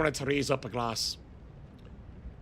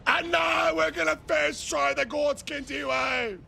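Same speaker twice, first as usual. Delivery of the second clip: hype announcement; 8 semitones higher than usual. Real, not acted. Announcing boss attempt.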